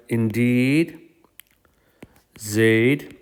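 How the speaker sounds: "Indeed" held and rising, pause before "Zaid"; stress on "Indeed". Shown as a demonstration. Teacher builds the double emphasis, stretching "Indeed". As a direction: stretch "Indeed", then leave "Zaid" hanging. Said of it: "really want to emphasize it".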